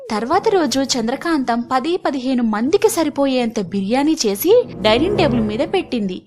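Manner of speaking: ordinary delivery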